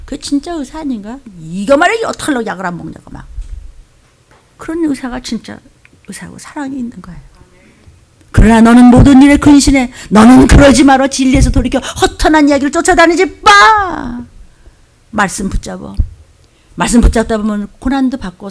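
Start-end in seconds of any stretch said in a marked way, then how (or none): 4.6-7.2 whispered point. Real, not acted. Preacher to congregation on true love, quick whisper. 8.3-14.2 much louder stern command; commanding shout. Real, not acted. Preacher ordering his flock, loud stern command.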